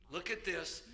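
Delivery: ordinary delivery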